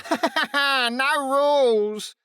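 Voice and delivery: slow nasal echo. A narrator's reading. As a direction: drag the words out nasally. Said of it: "echoed O'Neill in his nasal voice".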